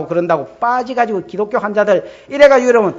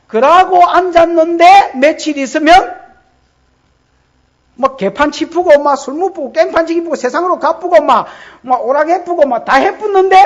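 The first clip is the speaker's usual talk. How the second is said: higher, louder, listing faster and faster; rising preaching rant; 7 semitones higher, 5 dB louder than usual. Acted. 설교자가 회중에게 타락을 나열하며, 높고 크게. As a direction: pile it on like a fired-up preacher.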